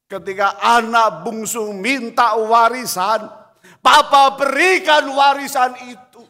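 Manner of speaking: loud, high pulpit cry; loud, high preaching; full-force preaching. Acted. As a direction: call it out to the whole church.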